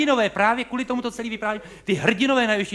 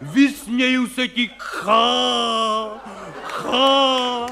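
hammed-up stage roar; 4 semitones higher, much slower than usual. Stage hero defies wind and death, hammed-up roar. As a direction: roar defiance like a ham actor. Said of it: "prostě řvou".

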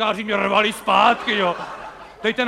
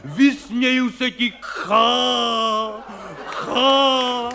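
hammed-up heroic roar; 3 semitones higher, much slower than usual. A stage hero yelling at wind and death, hammed-up roar. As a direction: rant at death like a ham actor, ending in a laugh. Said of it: "křičej tam, prostě řvou".

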